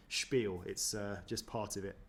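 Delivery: ordinary talk, nothing marked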